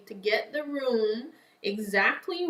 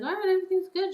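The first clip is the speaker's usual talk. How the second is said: much higher, sing-out reassurance; voiced hospital lady; 10 semitones higher, much faster than usual. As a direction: imitate a medic chirping that all is well.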